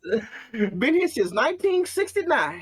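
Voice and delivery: put-on old church lady voice. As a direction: play the old church secretary lightly. Voicing the old church secretary.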